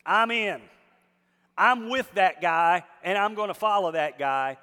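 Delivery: loud, fervent sermon voice; preaching at full force. Acted. Opening lines preached at full force.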